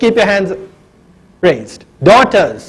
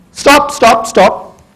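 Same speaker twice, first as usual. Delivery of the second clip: calling stop; 3 semitones higher, much slower than usual. Pretend. Policeman calls out to stop.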